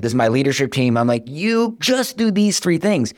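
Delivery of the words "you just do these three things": high, slow, spelling it out in exasperation; retelling a frustrated order, pitched high and slow; exasperated instruction. Retold or imitated. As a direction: lay it out slowly for the team, fed up. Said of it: "I'm frustrated".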